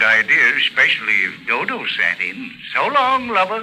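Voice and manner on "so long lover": flirty teasing farewell. Acted. Parting line to Novak.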